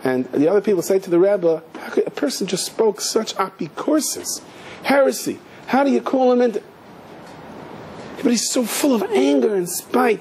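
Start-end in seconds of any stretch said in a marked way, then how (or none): 1.7-6.6 imitated indignant complaint; scandalized objection. Followers object to the rabbi. 8.2-10.2 high-pitched mock complaint; imitated protest, pitched well up; indignant accusation. Followers' complaint before rabbi's calm answer.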